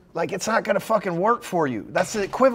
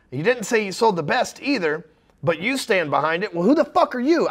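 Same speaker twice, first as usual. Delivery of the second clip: ordinary talk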